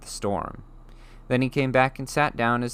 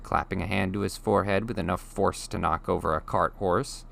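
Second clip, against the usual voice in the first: plain reading voice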